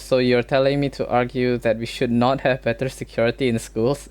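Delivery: normal talking voice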